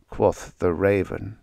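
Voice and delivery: ordinary delivery, nothing marked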